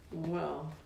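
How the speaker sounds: plain reading voice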